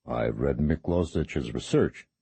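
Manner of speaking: low, slow and worn out; ragged with fatigue. A narrator's reading. Priest answering Balan, ragged, low and tired. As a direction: drag the words out, exhausted. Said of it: "His voice sounded ragged with fatigue".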